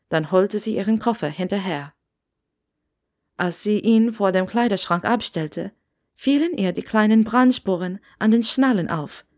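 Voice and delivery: ordinary delivery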